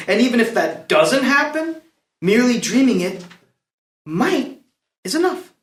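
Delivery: theatrical verse delivery, swelling and falling in pitch; stately, deliberate stage oration; dramatic closing monologue. Acted. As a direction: deliver the play's final speech to the court. Closing speech of play performed.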